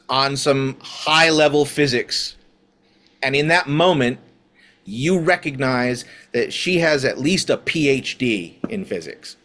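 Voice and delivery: ordinary talk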